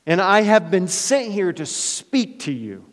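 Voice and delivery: high, loud, stern angel's rebuke; scolding angel. Acted. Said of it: "it feels a little bit like a scolding".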